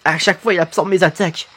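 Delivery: lightly acted low, quiet dialogue; game character, discouraged. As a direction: voice a defeated fighter, low and subdued. (voix de personnage découragé, basse et retenue)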